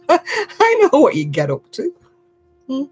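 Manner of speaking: high-pitched, laughing tease; real laughter in a high voice; amused teasing. Real, not acted. Teasing, laughing at own story.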